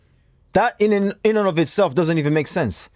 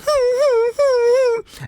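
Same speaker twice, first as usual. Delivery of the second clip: high whiny dog cry, no words; imitated dog whimper. Telling the dog story, imitates its high whimper. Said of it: "the dog was sat there crying".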